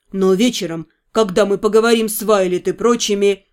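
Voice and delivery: put-on low, easy young man's voice; a low voice for the fiancé; male lover voice.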